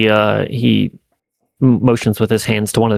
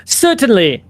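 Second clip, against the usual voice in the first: lightly voiced, higher-pitched game character; reply in a higher character voice; 11 semitones higher, slower than usual. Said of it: "I was like".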